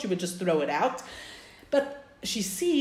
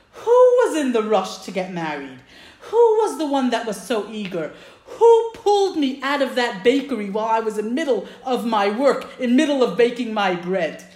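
loud, indignant comeback, acted out; storyteller's emphatic wifely rebuke; strong retort; 2 semitones higher, 6 dB louder than usual. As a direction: throw the accusation back at him. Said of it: "she disagrees very strongly".

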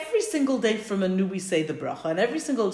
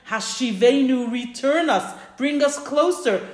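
slow, higher-pitched entreaty; earnest plea; 4 semitones higher, 4 dB louder, slower than usual. Acted. Congregation asks Hashem to bring them back, earnest slow plea. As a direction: appeal upward, phrase by phrase. Pleading prayer to Hashem, acted.

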